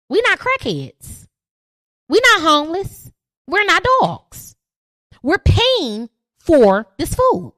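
slow, loud and hurt, each word hammered; indignant. Real, not acted. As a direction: rub it in their faces. Indignant rant at restaurants.

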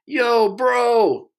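high, loud yell from the stands; stepbrother yelling. Retold or imitated. Stepbrother shouting down from the stands, high and loud. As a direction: shout it down from above like a rowdy fan. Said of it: "this voice from above the dugout goes".

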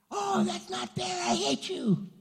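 put-on high, wailing, slow kid's protest; child tantrum. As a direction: whine it out like a furious kid. Child tantrum at parent's refusal.

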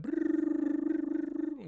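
long drawn-out brrrr up high; playful mocking wave sound effect; imitated psychic wave noise. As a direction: buzz it out like a cartoon power blast. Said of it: "he went".

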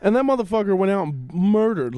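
normal talking voice

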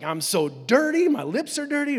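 loud, high acted panic; hammed-up alarm; freaking out. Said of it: "he's freaking out".

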